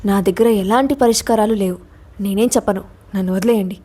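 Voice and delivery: ordinary delivery, nothing marked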